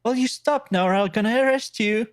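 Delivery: high-pitched mock English accent. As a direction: play an English policeman, pitched well up. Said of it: "that's my English accent".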